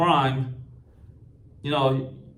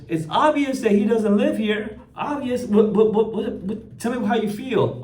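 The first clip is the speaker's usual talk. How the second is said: mocking the other guy; 8 semitones higher than usual. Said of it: "the other guy goes".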